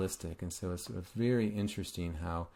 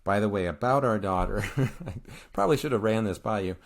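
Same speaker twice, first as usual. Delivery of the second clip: louder, breaking into a laugh midway; amused mock admission; 6 dB louder than usual. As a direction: play a sheepish confession with a chuckle. Voicing Zeus's sheepish admission, laughing.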